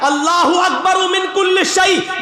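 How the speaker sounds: high, brisker proclamation; speeded up. Shown as a demonstration. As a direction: pick up speed and call it out. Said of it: "अभी speed बढ़ेगी मेरी". (तेज़, ऊँची आवाज़ में)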